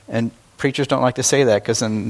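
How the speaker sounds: ordinary delivery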